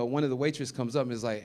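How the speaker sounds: ordinary talk, nothing marked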